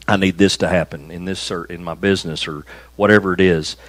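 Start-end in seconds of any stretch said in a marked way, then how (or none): none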